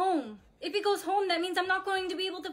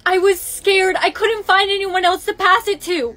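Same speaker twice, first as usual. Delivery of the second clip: loud quarrel; 12 dB louder than usual. Acted. Loud argument.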